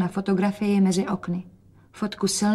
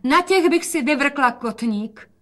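calling out; 6 semitones higher than usual. A narrator's reading. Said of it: "a volala".

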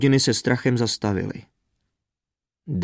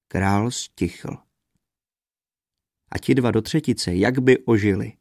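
ordinary delivery, nothing marked

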